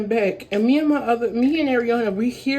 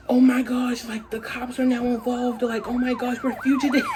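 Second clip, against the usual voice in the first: freaking out; 3 semitones higher than usual. Retold or imitated. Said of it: "I'm freaking out".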